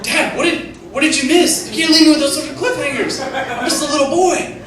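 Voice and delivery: put-on high, pleading little-boy whine; whiny little boy. Texting back to dad as a whiny little boy. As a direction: beg like a kid left hanging. Mock whiny reply to dad's text.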